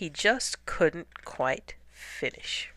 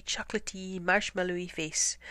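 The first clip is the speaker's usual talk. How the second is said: plain reading voice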